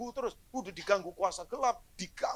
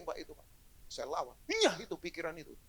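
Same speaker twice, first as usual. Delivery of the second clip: ordinary talk, nothing marked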